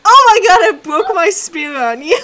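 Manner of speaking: loud, high outburst of disbelief; exasperated yell. Real, not acted. Blaming a friend for a broken spear, exasperated yell.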